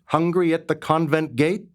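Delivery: usual speaking voice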